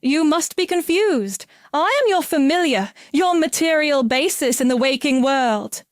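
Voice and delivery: high, firm and deliberate; purposeful. A narrator's reading. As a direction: insist with purpose. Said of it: "she said purposefully".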